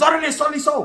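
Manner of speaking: high-pitched quick shout-out; mock shout-out. Shouting out the homegirl who knows who she is, high. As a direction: toss it out way up high.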